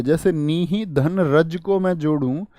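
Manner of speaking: normal talking voice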